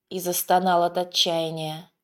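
ordinary delivery

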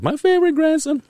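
very high-pitched gushing greeting; delighted grandma. Retold or imitated. Grandma greeting grandson on a call, imitated high delight. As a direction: squeal it like an overjoyed grandmother, imitated. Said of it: "she does says it".

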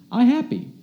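put-on high little-kid outburst of joy; gleeful exclamation. Nephew announces his joy for all to hear, gleeful exclamation. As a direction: imitate a happy toddler proclaiming it, high-pitched. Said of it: "he will exclaim for all to hear".

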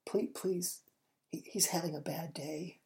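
hushed, nervous whisper; very quiet whisper. Acted. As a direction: whisper it so no one hears. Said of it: "very quietly they".

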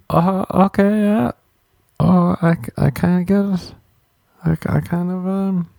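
baffled voice pushed up high; high, awkward mock acceptance. Comic mocking TikTok viewers, in a high baffled voice. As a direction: act unsure but agreeing. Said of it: "You're like".

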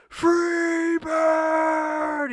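high, long drawn-out heckler's shout; screamed song request; full-volume yell. Retold or imitated. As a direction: holler it as loud as you can, stretching it out. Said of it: "as loud as I can".

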